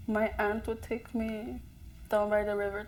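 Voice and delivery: ordinary talk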